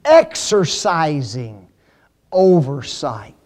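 EXERCISING and oversight spaced out slowly; slow, measured stress on "exercising oversight"; deliberate emphasis. Real, not acted. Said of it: "and then he emphasizes".

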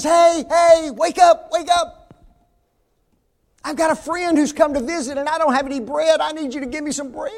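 loud, high shout to wake someone; urgent calling out. Acted. Man shouts to wake his neighbor for bread, urgent calling out. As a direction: holler through a shut door.

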